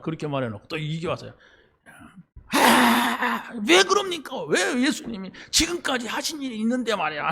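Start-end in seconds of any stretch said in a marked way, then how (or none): 2.5-7.3 high, strained protest in a put-on voice; protesting Peter. Peter arguing with Jesus in a high protest. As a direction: whine back at Jesus, pitched up. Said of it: "막 이러면서".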